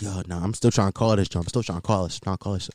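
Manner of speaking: low, soft muttering, fading away; retold muttered frustration. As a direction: mumble it to yourself while fiddling with the app. Retold fruitless attempts to book ride.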